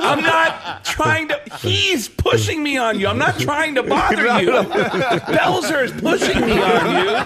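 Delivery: high, strained comic protest; mock pleading protest. Comedian retelling his excuse to Walken, mock pleading. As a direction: plead your case in a hammed-up high voice.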